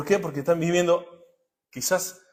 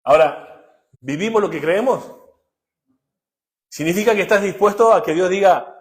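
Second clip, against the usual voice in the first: ordinary delivery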